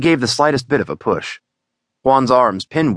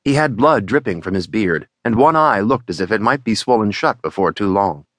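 usual speaking voice

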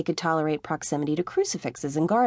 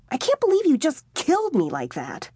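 higher, whiny indignant complaint; indignant young man; 8 semitones higher than usual. A narrator's reading. Tommy complains to Jody that she killed him, indignant. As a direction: protest like a wronged teenager.

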